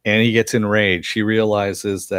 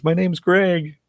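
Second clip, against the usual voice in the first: pitched-up goofy Greg line; a playful high-voiced echo; mock high; 9 semitones higher, slower than usual. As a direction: repeat "My name's Greg" high and silly. Repeating the line, higher.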